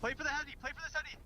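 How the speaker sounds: high excited call; urgent callout. Real, not acted. Urgent callout mid fight.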